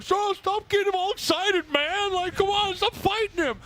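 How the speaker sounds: mock whiny teammate. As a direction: whine it like a teammate fed up with you. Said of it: "He'd be like".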